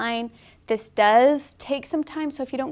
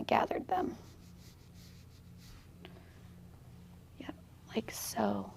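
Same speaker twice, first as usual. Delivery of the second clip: whispering; 15 dB quieter than usual. Real, not acted. Quiet remarks while painting.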